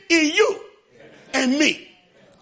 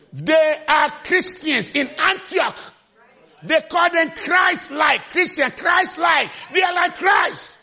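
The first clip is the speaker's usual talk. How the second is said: slow, raised preaching cry; preaching at full force; 5 semitones higher, slower than usual. Acted. Preacher declaring believers Christ-like, raised. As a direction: proclaim each word slowly.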